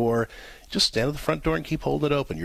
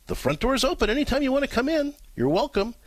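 calm welcome; 11 semitones higher than usual. Retold or imitated. Calm reply at front door.

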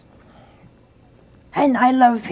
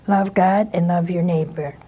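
usual speaking voice

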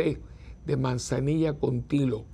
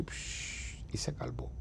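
unvoiced whisper, fading to nothing; soft whispered calm; whispered hush; 2 semitones lower, 12 dB quieter, slower than usual. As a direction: hush it like the sea going still. Storm stilled in retold story.

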